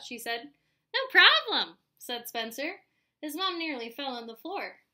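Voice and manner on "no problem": loud, high, breezy reply; eager agreement. A narrator's reading. Spencer happily gives up a toy, loud and eager. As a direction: agree instantly and brightly.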